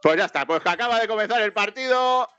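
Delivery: high, stretched-out sportscaster call; play-by-play commentary. Real, not acted. Commentator calls kick-off for Mexico, high play-by-play.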